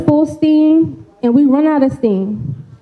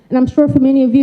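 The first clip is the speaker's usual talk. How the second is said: usual speaking voice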